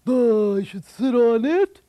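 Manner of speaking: loud, dopey giant voice; booming dull giant grunt; dim giant. Acted. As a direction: answer like a slow-witted giant.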